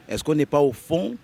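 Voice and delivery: normal talking voice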